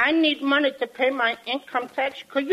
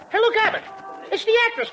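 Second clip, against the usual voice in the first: ordinary talk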